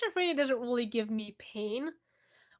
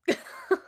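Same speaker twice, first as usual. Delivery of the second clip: short squeal of a laugh, pitched way up; high squeaky laugh; 6 dB louder than usual. Real, not acted. Giggling after telling the cat off. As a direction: let a high giggle escape.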